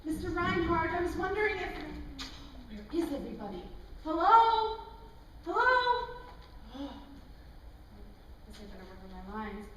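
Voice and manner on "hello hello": high loud hello into emptiness; calling out. Acted. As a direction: call out to find anyone. Calling into empty set.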